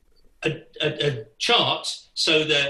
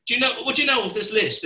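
fast, higher-pitched question; hurried, pitched-up ask; urgent question; 9 semitones higher, faster than usual. Retold or imitated. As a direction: rush the question at the drummer. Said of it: "And we said".

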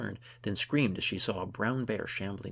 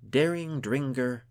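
slow, loud, raised declamation; poem recitation; 6 semitones higher, 9 dB louder, slower than usual. A narrator's reading. Gunhild continues her heroic poem, reciting. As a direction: declaim each word grandly. Composing heroic verse aloud.

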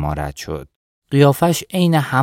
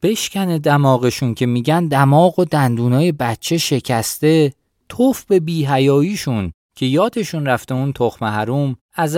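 cursing headman, pitched up, mildly louder; loudly ranting; 6 semitones higher than usual. A narrator's reading. Headman cursing the Shourabi folk before Jabbar, loud ranting. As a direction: rail against the villagers for all to hear. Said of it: "کدخدا هنوز بلند بلند میگفت".

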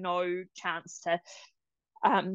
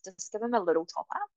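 lightly voiced nurse; a hint of a kindly nurse; nurse's advice. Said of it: "she was like".

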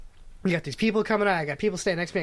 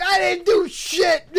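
high, loud, slow wail; hammed-up high wailing denial; wailing shout; 11 semitones higher, 6 dB louder, slower than usual. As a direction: cry it out like a cornered old man. Old man wailing.

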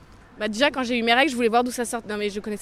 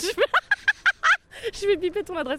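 laughing; 9 semitones higher, slower than usual. Real, not acted. Laughing high squeak.

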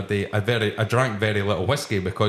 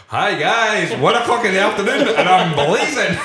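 laughing; 11 semitones higher, 6 dB louder than usual. Retold or imitated. Retelling arriving tipsy at lunch.

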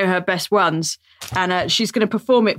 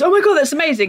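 gushing amazement; 6 semitones higher than usual. Retold or imitated. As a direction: imitate someone gushing in amazement. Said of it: "like Louis is doing now goes".

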